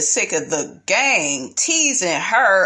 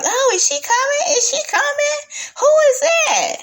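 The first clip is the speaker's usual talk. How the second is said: mocking fake surprise. Mocking the cast acting surprised in a high voice. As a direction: play dumb in a high silly voice. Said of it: "how stupid everybody was acting".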